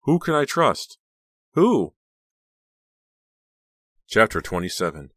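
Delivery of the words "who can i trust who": high, loud, anguished questions; panicked and torn between them; desperate self-questioning. A narrator's reading. Torn heroine questions herself desperately.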